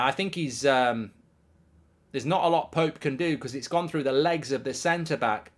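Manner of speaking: normal talking voice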